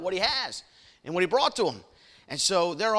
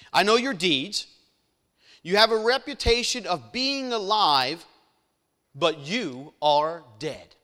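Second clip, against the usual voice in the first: louder, proclaiming Bible reading; scripture reading; 4 dB louder than usual. Acted. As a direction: read the verse out to the congregation. Reads scripture aloud.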